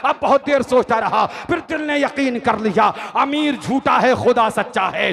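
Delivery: loud, high-energy sermon delivery; impassioned rhythmic declamation; full-force preaching. A narrator's reading. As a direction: hammer each phrase out to a large crowd.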